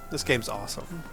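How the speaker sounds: ordinary delivery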